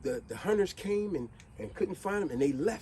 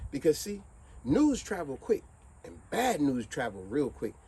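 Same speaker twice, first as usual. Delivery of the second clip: ordinary talk, nothing marked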